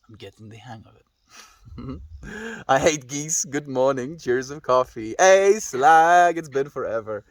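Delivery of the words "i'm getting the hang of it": dramatic poetry reading. Shown as a demonstration. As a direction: read it like a solemn poem with long pauses. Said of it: "Extra long pause".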